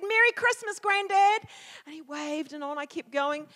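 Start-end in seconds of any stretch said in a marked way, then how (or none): 0.0-1.5 high, loud cheery holler; called-out greeting. Retold or imitated. Kid biking past calls to friend's granddad, calling out. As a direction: call it out across the yard, pitched up.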